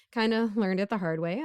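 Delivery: ordinary talk, nothing marked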